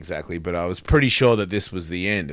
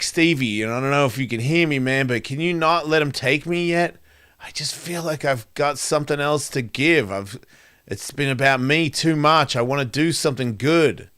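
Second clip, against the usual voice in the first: higher, pleading voice to a lost friend; raised, earnest begging; pleading prayer; 6 semitones higher than usual. Retold or imitated. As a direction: plead with Stevie not to let go. Praying to dead friend, hospital.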